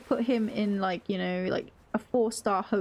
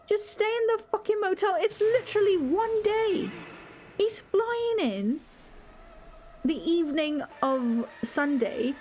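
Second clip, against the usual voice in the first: higher, frustrated ranting at an absent coworker; venting angrily about a fussy colleague; exasperated rant; 5 semitones higher than usual. Real, not acted.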